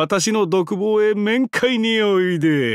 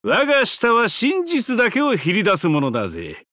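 usual speaking voice

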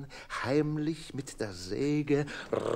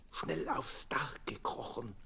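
whispered conspiratorial plan; sneaky rascals whispering; secret whisper; 8 dB quieter than usual. Acted. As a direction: hush it like a schoolboy plotting. Boys plotting secretly.

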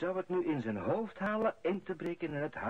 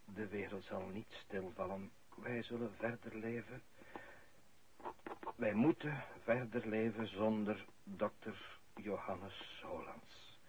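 solemn eulogy; 4 semitones lower, 5 dB quieter than usual. Acted. Priest at the funeral, a solemn eulogy. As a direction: speak as if over a grave, softly.

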